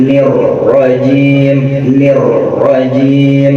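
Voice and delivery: "nir rajim" chanted slowly, the r held and rolled without a break; slow, unbroken rolled ra in "nir rajim"; nir rajim with flowing ra. Shown as a demonstration. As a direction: keep "nir" running smoothly into "rajim". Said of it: "Perhatiin bacaan auju".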